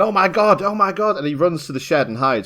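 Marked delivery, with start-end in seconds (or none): 0.0-1.2 higher-pitched panicky voice, played for laughs; mock panic. Podcaster retelling a character's first fright to co-hosts, mock panic. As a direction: imitate someone starting to panic. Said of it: "He starts off panicking, going".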